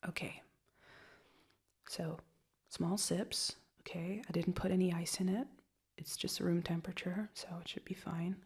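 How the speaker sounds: soft, caring whisper for a migraine. Real, not acted. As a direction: whisper gently to a sick partner. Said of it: "that's soft voices".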